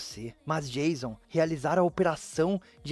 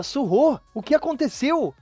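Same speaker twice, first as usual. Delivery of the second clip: high, worried call; alarmed shout; 7 semitones higher, 3 dB louder than usual. Acted. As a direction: shout on finding her on the floor. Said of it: "E ele grita". (grito preocupado)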